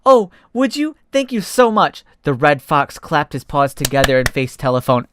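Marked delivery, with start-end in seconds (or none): 0.1-2.0 much higher thanks; delighted. Acted. Fox thanking the cheetah, much higher and delighted. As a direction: gush with gratitude.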